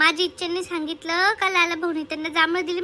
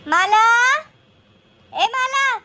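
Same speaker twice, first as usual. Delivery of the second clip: loud call to a neighbour; 5 semitones higher, 6 dB louder, much slower than usual. Acted. Woman calling her neighbour Mala loudly from outside. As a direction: call her name across the yard.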